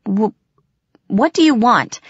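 a touch of nervous tremble, pitch lifted; slightly trembling. A narrator's reading. Frightened girl questioning the eerie cat, voice trembling slightly. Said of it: "my voice only trembled a little bit".

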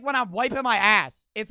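ordinary talk, nothing marked

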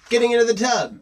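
high, lively call; mock-dramatic announcement. A narrator's reading.

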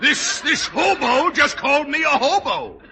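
indignant outburst. Acted. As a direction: explode at the question. Official outraged at insult.

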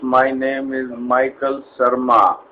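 polite Indian call-centre greeting; Indian accent. Acted. Agent greeting a caller in Indian accent.

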